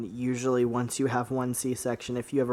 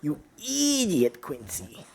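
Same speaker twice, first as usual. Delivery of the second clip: yappy small dog; 2 semitones higher, slower than usual. Acted. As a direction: yip it out like a tiny dog. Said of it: "your Chihuahua voice".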